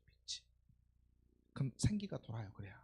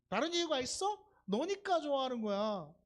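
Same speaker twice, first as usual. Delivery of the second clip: ordinary talk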